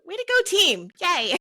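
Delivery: high, loud cheer for the team. Real, not acted. Host rallying the gaming community with praise, high excited cheer. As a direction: cheer them on, pitch leaping up.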